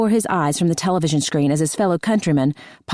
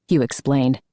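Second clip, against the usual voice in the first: usual speaking voice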